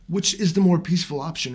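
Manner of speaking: usual speaking voice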